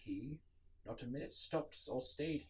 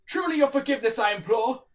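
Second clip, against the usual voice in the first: raised, urgent apology through the door; pleading call; 13 semitones higher, 15 dB louder than usual. Acted. Narrator apologizes loudly to the knocking visitor, pleading. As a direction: call out a hurried excuse to the unseen visitor.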